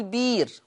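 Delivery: بير: الهمزة ياء ممدودة; قل بير بلا همز; بير بالإبدال. Shown as a demonstration. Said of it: "يقرأ ورش بالإبدال يقول بير". (بير بإبدال الهمزة)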